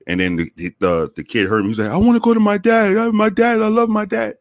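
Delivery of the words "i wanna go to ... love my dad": put-on high, louder pleading kid voice; imitated pleading boy. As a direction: beg for dad like the boy. Voicing the boy begging for dad.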